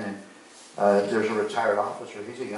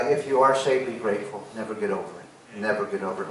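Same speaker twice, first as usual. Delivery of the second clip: ordinary talk, nothing marked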